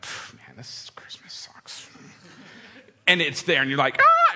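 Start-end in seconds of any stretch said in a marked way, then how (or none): 0.0-1.9 whispered, slow sulk; dejected whisper. Retold or imitated. Kid grumbling about a bad Christmas in a whisper. 4.0-4.3 high, loud squealing scream; girlish scream. Retold or imitated. Kid finding the Nintendo, loud high-pitched scream. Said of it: "screaming like a girl".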